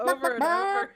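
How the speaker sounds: high helpless laughter; laughing hard. Real, not acted. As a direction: crack up uncontrollably. Cracking up at a sound.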